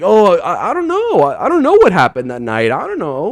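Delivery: hammed-up nervous "I don't know"; mock flustered denial. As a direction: pitch leaps way up on the first "I don't know". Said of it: "Be like".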